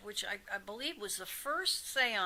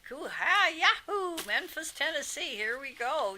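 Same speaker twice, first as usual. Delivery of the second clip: higher, loud Southern whoop from a rock-and-roll star; 7 semitones higher, 5 dB louder than usual. Retold or imitated. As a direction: whoop it out like a hyped-up Memphis showman with a Southern accent. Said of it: "But this guy took right over".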